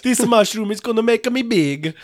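high, loud goofy game voice; cartoon game hero voice. Friend goofing as a video game plumber, high and loud.

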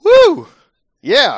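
high, loud whoop; cheer. Real, not acted. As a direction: whoop like a fan. Cheering at the announcement.

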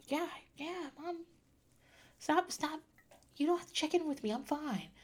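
put-on high, soft, dismissive kid voice; imitating her son. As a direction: brush off mom like a kid hiding nerves. Child brushing off his mom.

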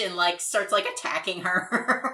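ordinary talk, nothing marked

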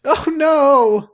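very high mock alarm; squeaky voice.

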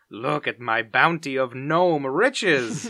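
loud, slow gloating villain line; stretched-out mock-villain proclamation; Doofenshmirtz. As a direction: crow grandly over stolen loot, drawn out. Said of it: "as he's stealing all the gnomes, says".

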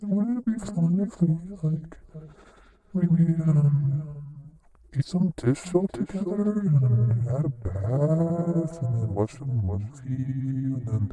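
very high sing-song wheedling wife voice; high pleading housewife. Acted. Wife begging husband to drink dish soap, squeaky high voice. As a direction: coax your husband in a squeaky sweet voice.

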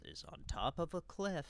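usual speaking voice

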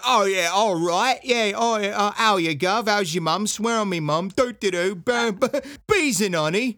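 high, loud hammed-up Cockney accent; mock Cockney. Host imitates the Cockney guard at the detention facility, hammed-up Cockney. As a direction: ham up a Cockney geezer, all rhyming slang. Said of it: "there's a Cockney man working there. He's like".

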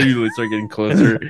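ordinary delivery

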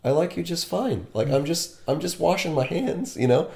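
high-pitched, mild reassurance; placating. Retold or imitated. Acting out his placating reply to her.

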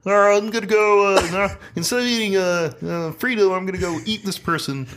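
high, halting goofy slug voice. Acted. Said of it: "A different slug voice".

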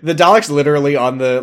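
usual speaking voice

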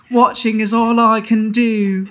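high, loud mock child; little child voice. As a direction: join in as a plaintive tot. Mimics the British child's voice.

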